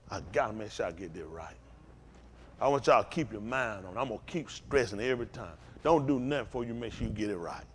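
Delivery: low, soft, earnest pulpit talk; hushed, low-pitched exhortation; subdued preaching. Real, not acted. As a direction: bring it right down and counsel them quietly. Sermon drops to earnest low register.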